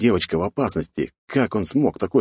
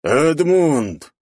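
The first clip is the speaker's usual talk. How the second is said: raised, drawn-out imperious shout; commanding yell; 10 semitones higher, 5 dB louder than usual. A narrator's reading. Count summons his loyal knight, imperious yell. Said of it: "заорал властным голосом граф".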